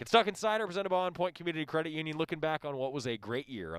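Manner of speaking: high, fast show-intro read; radio announcer. Acted. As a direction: sell the show title with punch.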